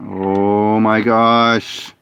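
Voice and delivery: very slow, weary oh my gosh; drawn out. Real, not acted. Fed-up gamer sighing at everything going wrong. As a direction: drag the words out wearily.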